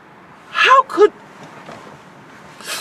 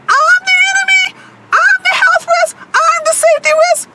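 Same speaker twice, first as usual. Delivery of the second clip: much higher, shrill sarcastic mimicry; mocking quote; 4 dB louder than usual. Mimicking the German view of her in a high, sarcastic voice. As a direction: parrot the accusation in a high, ridiculing voice. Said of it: "and you think".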